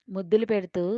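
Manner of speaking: ordinary delivery, nothing marked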